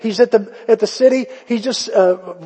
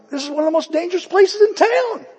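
high voiced wife, half-mock; wife's voice; 13 semitones higher, faster than usual. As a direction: lift into a light imitation of his wife. Wife's retold reply, high voice.

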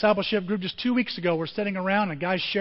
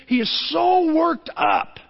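worked up; 10 semitones higher, 6 dB louder, slower than usual. Acted. Preacher acting Jesus's emotion.

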